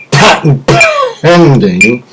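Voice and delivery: "patent pending" stretched slow in a put-on voice; slow, high, drawn-out patent pending. Shown as a demonstration. Joking about his own invention, slow mock official voice. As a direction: draw out "pending" in a comic announcer tone.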